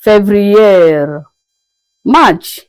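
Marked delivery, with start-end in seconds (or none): 0.0-1.2 Février as "fev-ree-YER"; French pronunciation. Shown as a demonstration. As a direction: say Février the French way, last syllable held. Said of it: "February is being pronounced as Février".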